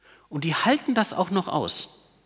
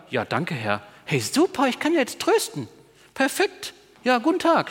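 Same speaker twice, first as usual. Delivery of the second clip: sarcastic chirpy gratitude, rising high; overly bright sarcastic thanks to God; mock cheerful thanks; 7 semitones higher than usual. Sufferer mocking cheap comfort, sarcastic high cheer. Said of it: "und sagen".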